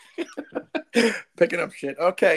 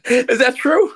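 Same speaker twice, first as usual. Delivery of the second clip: 1 amazed disbelief; 8 semitones higher, 11 dB louder, much slower than usual. Real, not acted. Host stunned by the story.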